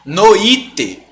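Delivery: Noite as "no-Í-te", three syllables; accent on the i. Shown as a demonstration. Teacher warning of the mistake, Noite said no-Í-te. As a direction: stress a separate Í inside Noite. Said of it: "colocando um acento no i".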